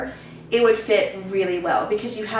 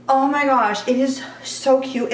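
excited; 4 semitones higher than usual. Real, not acted. Gushing over a cute dress.

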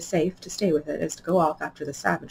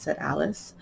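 ordinary delivery, nothing marked

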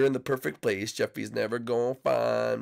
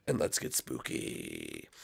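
very low, slow and soft spooky voice; deep slowed-down spooky tone; 9 dB quieter, much slower than usual. Pretend. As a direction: drop into a deep creepy drawl.

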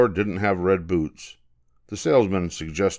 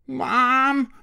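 high wailing call; child wailing Mom. Acted. Matilda wails to her mother after falling, high wail. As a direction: wail for mum. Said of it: "she wailed".

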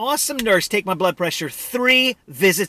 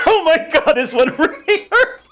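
laughing; 7 semitones higher than usual. Real, not acted. After a painful squeeze.

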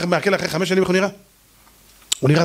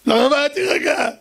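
panicked protest; 8 semitones higher, slower than usual. Acted. Servant protests.

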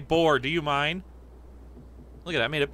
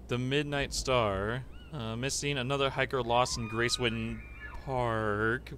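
low, soft read-aloud; subdued, solemn report; newspaper reading; 6 semitones lower, 6 dB quieter than usual. Acted. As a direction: read the headline out in a hushed, lowered voice. Reads game newspaper aloud.

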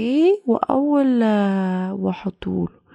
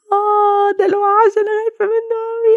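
high, loud crying scream of fear; a child bursting into frightened tears; child crying out in terror at the monster; terrified crying; 11 semitones higher, 6 dB louder than usual. Acted.

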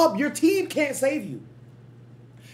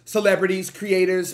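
usual speaking voice

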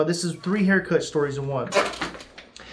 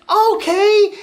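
high, louder mock "okay"; baffled acceptance; 14 semitones higher, 5 dB louder than usual. Retelling stumbling into Pride Day, baffled acceptance. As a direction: go along with it in high-pitched disbelief. Acted bewildered reaction in story.

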